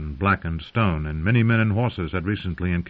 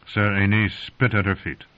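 ordinary delivery, nothing marked

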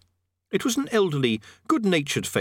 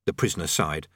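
ordinary delivery

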